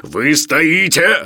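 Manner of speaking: loud, very slow, heavy anger; growled outburst. A narrator's reading. Professor rounds on Sharikov at dinner, growling. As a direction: growl it out word by word. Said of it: "рычал Филипп Филиппович".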